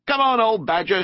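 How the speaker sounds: high, calling shout. A narrator's reading. Rat hailing the badger by the hedge, shouting. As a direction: call out heartily to someone at a distance. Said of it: "shouted the rat".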